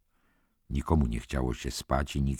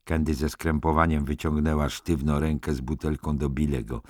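ordinary delivery, nothing marked

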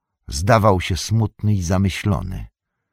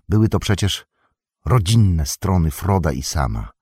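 usual speaking voice

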